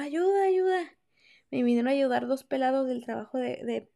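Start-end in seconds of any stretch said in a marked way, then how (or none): 0.0-0.8 calling for help. Retold or imitated. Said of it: "yo gritaba".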